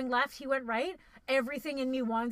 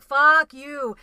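retold angry curse; 2 semitones higher, 12 dB louder than usual. Retelling cursing at road-rage driver.

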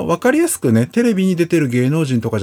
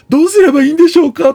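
high, loud and fast pleading; desperate plea; 8 dB louder, much faster than usual. Retold or imitated. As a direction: plead in a panicked rush. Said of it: "っていう". (高く大きな声で早口に懇願)

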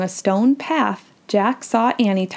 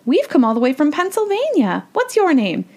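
put-on high, chirpy child character voice; eager young girl; 7 semitones higher than usual. A narrator's reading. Annie chats to a new friend, eager. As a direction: chatter eagerly in a higher child's voice.

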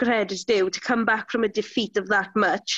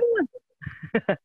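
mock exasperation; 12 semitones higher, slower than usual. Real, not acted. Friend stole her thunder.

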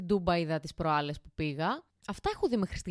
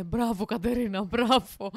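mock proud parents praising, a touch louder; parents cheering her on; 3 semitones higher, 5 dB louder, slower than usual. Parents praising little Katerina for finally throwing up, mock cheering. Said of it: "μου λέγανε".

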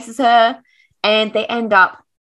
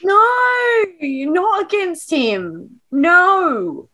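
dismayed protest; 9 semitones higher, much slower than usual. Real, not acted. Outraged at the book.

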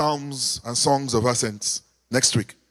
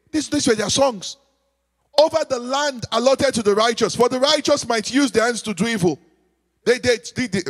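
raised, ringing preacher's call to the church; preaching; 5 semitones higher than usual. Acted. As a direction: preach it out to the congregation, high and strong. Preacher leading the congregation.

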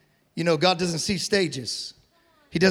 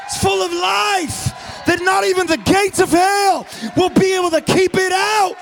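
preaching at full force; 9 semitones higher, 6 dB louder than usual. Acted. Pastor firing up the congregation, full-force preaching. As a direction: build the sermon to a roar.

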